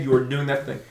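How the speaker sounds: usual speaking voice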